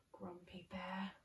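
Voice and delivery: soft whispered warning; hushed conspiratorial aside. Pretend. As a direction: whisper it so bear won't hear. Hushed aside about bear.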